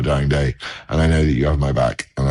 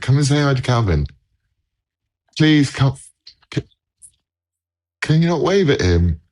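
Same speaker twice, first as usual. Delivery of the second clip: higher pitched call to the next room; 8 semitones higher than usual. Real, not acted. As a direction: call his wife over, coaxing and raised. Calling wife to the camera.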